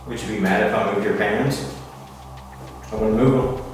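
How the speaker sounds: ordinary talk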